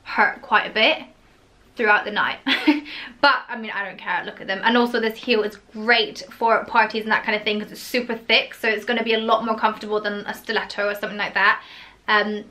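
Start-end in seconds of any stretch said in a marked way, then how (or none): none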